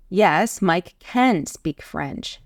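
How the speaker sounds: firm "Yes", then slow emphatic "can"; stress on CAN. Shown as a demonstration. Answering someone doubting Mike, insistent stress on "can". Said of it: "Imagine someone is doubting Mike".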